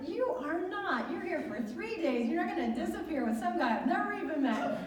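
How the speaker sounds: high, loud, laughing mock scold; mock scolding friend. [name] forbidding a ride with a stranger, hammed-up laughing scold. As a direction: play the protective friend putting her foot down.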